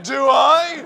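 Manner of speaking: loud, high defiant question; defiant shout. Retold or imitated. Comedian defying people who say he needs air. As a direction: shout the challenge back.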